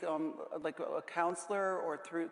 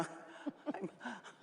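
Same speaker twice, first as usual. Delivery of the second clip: a short airless laugh mid-sentence; breathy laugh; 4 semitones higher, 10 dB quieter than usual. Real, not acted. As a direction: let a silent laugh slip out. Laugh inside mock excuse.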